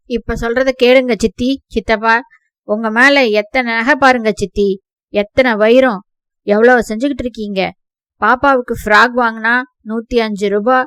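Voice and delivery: loud, bitter shouted complaint; angry outburst. Acted. Nephew shouts at aunt and uncle, loud bitter rage. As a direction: let years of resentment burst out loud.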